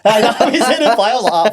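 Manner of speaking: high, loud, laughing reply. Real, not acted. Friend agreeing about the match clash, laughing. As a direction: burst out laughing while agreeing.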